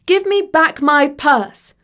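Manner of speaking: high, slow, insistent demand; indignant order, each word drawn out. A narrator's reading. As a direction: demand it back firmly. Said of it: "she demanded".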